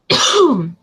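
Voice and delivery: another choking cough; real cough from water down the wrong way. Real, not acted. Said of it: "choked a little bit".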